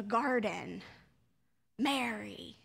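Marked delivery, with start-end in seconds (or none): 1.8-2.6 soft, lower, gentle Mary; tender naming. Shown as a demonstration. The risen rabbi greeting Mary in the garden, soft. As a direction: speak her name in quiet recognition. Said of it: "she knows in an instant".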